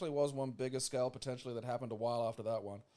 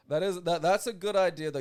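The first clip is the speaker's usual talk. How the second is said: excited; 7 semitones higher, 9 dB louder than usual. Real, not acted. Excited reaction.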